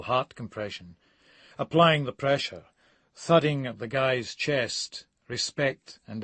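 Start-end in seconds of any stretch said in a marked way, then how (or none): none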